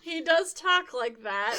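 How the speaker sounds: talking through laughter; giggling while speaking; laughing. Real, not acted.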